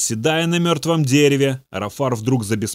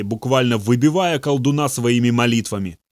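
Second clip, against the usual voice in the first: usual speaking voice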